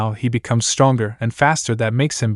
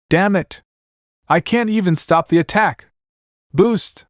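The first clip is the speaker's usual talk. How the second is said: frustrated, higher shouting; angry shout; 7 semitones higher than usual. A narrator's reading. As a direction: yell it in frustration. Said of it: "He shout out in anger".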